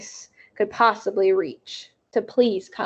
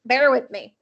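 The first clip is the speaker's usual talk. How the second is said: ordinary delivery, nothing marked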